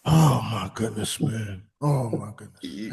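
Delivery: low, slow, weary disbelief; hammed-up low lament; mock dismay. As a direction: sink into a deep, drawn-out mock groan of dismay.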